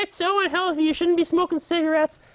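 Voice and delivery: high, whiny mock of preachy friends; nagging old friends. Old friends telling him off about smoking, mocking high voice. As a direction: imitate scolding friends up high. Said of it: "my old friends told me".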